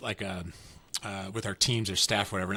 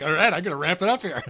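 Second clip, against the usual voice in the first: higher and louder, laughing through it; ramping it up; 7 semitones higher, 6 dB louder than usual. Retold or imitated. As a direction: pump the energy up on purpose. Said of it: "I'm like".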